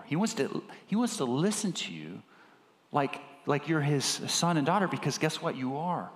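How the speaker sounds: ordinary talk, nothing marked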